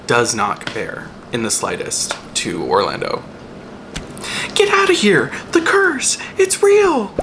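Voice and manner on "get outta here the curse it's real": excited shout. Real, not acted. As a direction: shriek it like a thrilled fan. Excited ride outburst.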